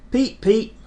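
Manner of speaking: high whistle call; whistle peep. Acted. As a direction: answer like a train whistle. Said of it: "answered Thomas".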